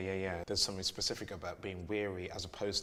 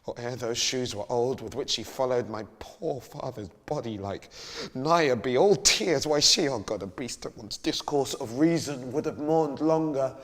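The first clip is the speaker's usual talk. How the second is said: loud rising anguished outburst; Hamlet, grieving and furious; 5 semitones higher, 12 dB louder, slower than usual. Acted. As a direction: build to a furious grieving cry. S0 performs soliloquy passage.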